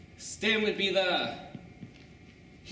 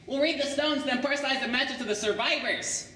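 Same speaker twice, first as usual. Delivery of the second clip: higher, eager geek voice; Stan explaining; 6 semitones higher than usual. Acted. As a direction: pitch the scheme like the clever geek. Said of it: "He says".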